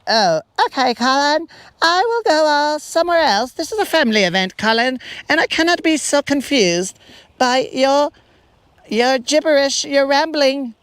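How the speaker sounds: a woman backing away from rambling; mock confused woman. The woman rebuffing Colin, hammed-up high flustered voice. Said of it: "she's all like".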